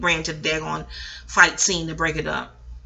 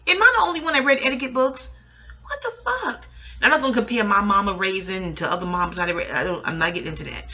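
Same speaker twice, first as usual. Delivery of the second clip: ordinary delivery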